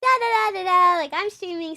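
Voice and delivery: high, loud, bubbly streamer chatter; over-enunciated, loud and high streamer babble. Shown as a demonstration. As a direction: project it like you're live on stream. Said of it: "streamer voice, like, when you're talking".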